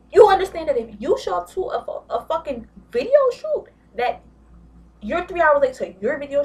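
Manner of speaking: shouted lecture at a spoiled brat; loud exasperated lecture. Real, not acted. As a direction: hammer it in, fed up. Lecturing Rashida loudly.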